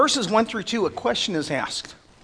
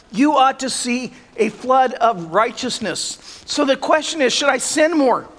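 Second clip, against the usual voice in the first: higher and louder, pressing each line home; preaching at full force; 5 semitones higher, 4 dB louder than usual. Acted. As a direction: preach it hard to the congregation.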